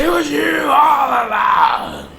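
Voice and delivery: high, loud, drawn-out wail; hammed-up accusing shout. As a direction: stretch it into a melodramatic shout. Acting out the mother's accusation.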